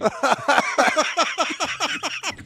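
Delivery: loud high burst of laughter; big laugh. Real, not acted. Host cracking up at his own story, loud laugh.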